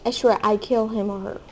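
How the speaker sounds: normal talking voice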